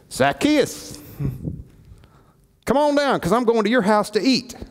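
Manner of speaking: high, ringing call upward. Acted. Jesus calling Zacchaeus down from the tree, high ringing call.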